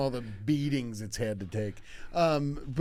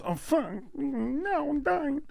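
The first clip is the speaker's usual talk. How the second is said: high, put-on moan; mock dying teacher; 12 semitones higher, much slower than usual. Injured teacher thinks he is dying, comic high wail. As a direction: pitch it way up in mock distress. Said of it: "What happened was he goes".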